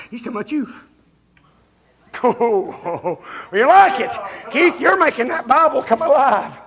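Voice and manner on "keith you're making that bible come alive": ordinary talk, nothing marked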